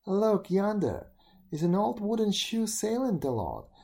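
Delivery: put-on high, eager girl's call; excited girl. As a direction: point it out excitedly. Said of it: "said one of the girls".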